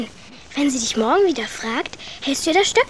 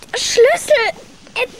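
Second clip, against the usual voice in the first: high, louder panicked cry over the lock; 7 semitones higher, 4 dB louder than usual. Acted. Gretel frantic at the stuck lock, panicked. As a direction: fight the stuck key in rising panic.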